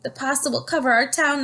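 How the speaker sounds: high, alarmed cry; crying out in fear. A narrator's reading. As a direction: wail it to the whole town. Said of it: "they cried".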